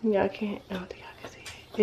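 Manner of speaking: hushed whisper aside. Real, not acted. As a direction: whisper it to the camera. Whisper aside during bath.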